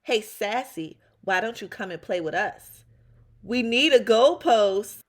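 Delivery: loud mocking yell. Brother taunting Sassy from the football game, loud yell. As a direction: holler the taunt across the yard. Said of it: "Huey yelled out".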